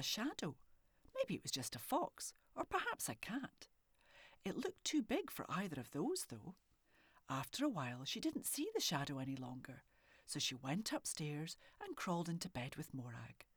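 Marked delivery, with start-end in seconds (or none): none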